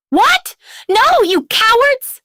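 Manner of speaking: very high, loud outraged yell; furious snarl. A narrator's reading. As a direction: rage at the fleeing crew. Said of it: "he snarled".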